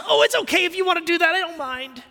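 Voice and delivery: high, fast, limp pushover voice; a weak, pathetic mock of a timid believer. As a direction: simper it like a timid doormat. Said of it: "weak, pathetic sadness of Christians".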